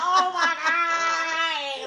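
high, drawn-out wailing lament; mock wail. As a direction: stretch it into a laughing, mock-crying wail. Retells own reaction to arrest night.

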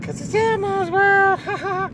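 very high, loud squeaky puppet voice with a giggle; Elmo voice. Retold or imitated. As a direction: squeak it out like Elmo, ending in a laugh. Punchline in Elmo's voice.

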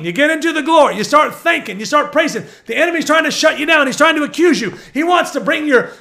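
loud, high, driving preacher delivery; impassioned preaching. Real, not acted. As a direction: preach it at full force, hammering each phrase. Preacher building to full force.

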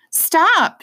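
high, loud sharp command; shout. Acted. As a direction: stop the child at once. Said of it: "she shouted".

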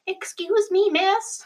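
high-pitched imitation of a small child; little boy's voice. Student asking the art teacher for help, high child voice. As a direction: put on a high little-kid voice. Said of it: "he speaks very quickly and not particularly clearly".

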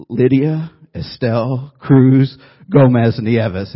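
arguing with his wife, spelling out the name; insistent name recital. Retold or imitated. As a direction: insist on every name, slow, in Spanish.

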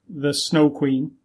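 ordinary talk, nothing marked

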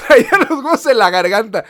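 laughing through the words; cracking up while saying it. Real, not acted. Laughing hard while retelling his nerves at customs.

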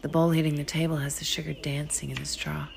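usual speaking voice

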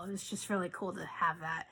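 holding back tears while speaking; real quiet emotion welling up; getting emotional. Real, not acted. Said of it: "Sorry, I don't mean to get emotional".

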